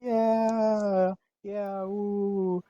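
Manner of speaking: high, whooping hype; excited cheering. Acted. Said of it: "who is excited?".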